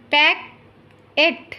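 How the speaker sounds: slow, stretched packet; slow careful model word. Shown as a demonstration. Teacher modelling packet slowly for listening pupils. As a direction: draw out packet for the class. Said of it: "listen to your teacher say the following words".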